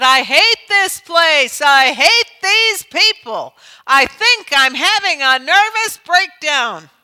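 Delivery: high, loud, slow anguished cry of despair; sobbing outburst. Retold or imitated. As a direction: wail it out like someone at the end of her rope. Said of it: "She was sobbing".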